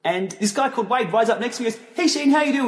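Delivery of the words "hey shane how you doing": high, lightly imitated Canadian greeting; Canadian accent. Cycling blogger Wade greets a fellow rider, in imitated Canadian accent. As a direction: mimic a friendly Canadian accent, pitched up. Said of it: "with this Canadian accent".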